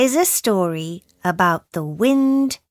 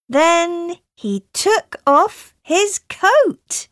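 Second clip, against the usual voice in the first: plain reading voice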